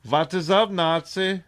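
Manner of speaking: Nazi bro greeting in a fake German accent; mock German Nazi greeting. Comedian imitates a Nazi greeting his homies, in a mock German accent. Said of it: "Nazis rolling around with homies like".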